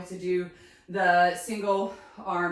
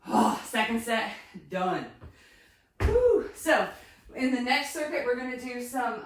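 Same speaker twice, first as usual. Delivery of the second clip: ordinary talk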